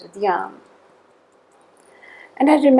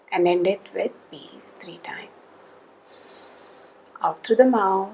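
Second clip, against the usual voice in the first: guided calming; 6 dB quieter than usual. Shown as a demonstration. Leads the listener through wrist squeeze and breaths, calm and soft. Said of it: "leads the breathing close".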